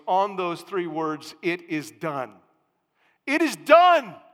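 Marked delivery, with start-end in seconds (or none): 3.2-4.2 shouted high and loud; exclamation point. Shown as a demonstration. Preacher booming the angel's words to his congregation, shouted. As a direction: hit it like a thunderclap. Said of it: "Exclamation point".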